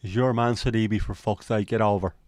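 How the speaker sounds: Irish football grumble; put-on Irish lilt at near-normal pitch; Irish accent. Shown as a demonstration. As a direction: say it as an Irish fan would. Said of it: "The Sidibe line in an Irish accent".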